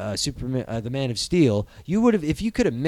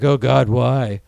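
mock anguish; 5 semitones lower, 4 dB louder, slower than usual. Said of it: "Be like".

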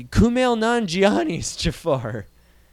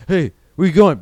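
louder retold call after the kids; mock usher call; 2 semitones higher, 7 dB louder than usual.

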